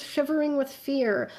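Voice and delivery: ordinary talk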